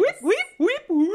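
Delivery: very high, loud squeaking whips; squeaky guinea pig noise. Shown as a demonstration. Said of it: "That sounds like ... a guinea pig".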